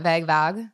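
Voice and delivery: vague said as "vag", short a, questioning; odd pronunciation. Shown as a demonstration. Co-host puzzling over the word, vague as "vag". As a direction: try vague rhyming with bag, rising at the end. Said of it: "added a new accent to it".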